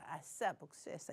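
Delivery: low, soft aside to oneself; inner gloating. Acted. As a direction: gloat secretly under the polite surface. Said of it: "하면서 속으로". (속으로 고소해하듯 낮게)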